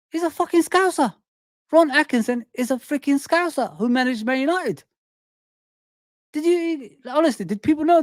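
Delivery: high-pitched, astonished exclamation; incredulous reveal. Real, not acted. Host tells viewers a manager's surprising origins, incredulous. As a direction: blurt out the shocking news in disbelief. Amazed reveal about Ron Atkinson.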